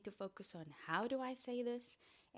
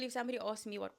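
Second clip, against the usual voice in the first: ordinary delivery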